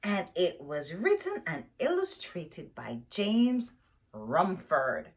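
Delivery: ordinary delivery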